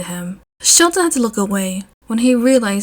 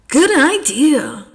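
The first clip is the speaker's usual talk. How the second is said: higher, slow sing-song agreement; slow, higher hammed-up Mike; eager fanfic Mike; 6 semitones higher, 4 dB louder, much slower than usual. A narrator's reading. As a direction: ham up the cheesy romance.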